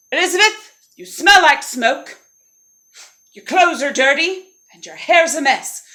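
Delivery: high, loud, fussy complaint; disgusted prince. Acted. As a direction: scold your rescuer with snooty emphasis. Prince scolds his rescuer.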